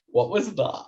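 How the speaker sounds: normal talking voice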